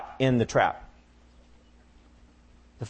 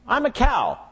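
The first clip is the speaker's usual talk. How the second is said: high squeaky comic realization; mock realization; 12 semitones higher than usual. Cow realizes she is a cow, high comic squeak. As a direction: squeak it as a sudden joke.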